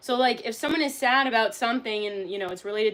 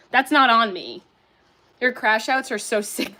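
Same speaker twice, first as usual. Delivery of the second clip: ordinary talk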